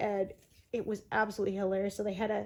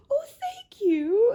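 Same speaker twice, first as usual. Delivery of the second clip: very high, slow, loud, drawn-out thanks; flattered Rowena; 7 dB louder, much slower than usual. Retold or imitated. Rowena thanks Dean for the compliment, high and drawn out. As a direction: coo it back, high and slow. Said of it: "she says".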